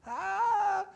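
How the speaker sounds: high, loud "ah!"; sudden outcry. Acted. Player crying out mid-layup in a basketball game, sudden outcry.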